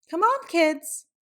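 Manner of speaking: put-on mom calls the kids from downstairs; calling out. A narrator's reading. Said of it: "she called".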